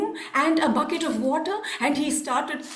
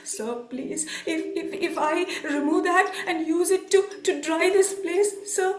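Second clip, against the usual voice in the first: timid begging. Acted. Poor coolie begs merchant to spare his coat, timidly. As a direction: beg haltingly, afraid to offend.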